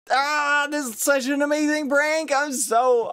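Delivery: high, thrilled squeal; high-pitched delight at your own scheme; excited gloating. Real, not acted. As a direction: gush in a high, giddy voice. Gloating over the prank.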